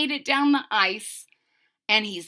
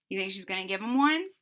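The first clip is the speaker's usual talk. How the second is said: ordinary delivery, nothing marked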